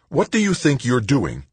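burly medic angrily confronting the patient; angry medic. A narrator's reading. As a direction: bark at the patient out of bed. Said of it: "She was clearly angry".

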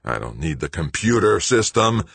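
plain reading voice

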